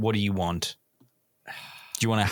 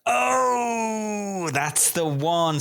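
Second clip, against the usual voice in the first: a high excited cry, then slower; 10 semitones higher, much slower than usual. Real, not acted. Host cheers when a player gets the answer.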